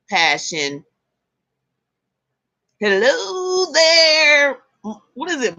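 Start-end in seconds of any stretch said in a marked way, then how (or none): 2.8-4.6 drawn-out greeting. Real, not acted.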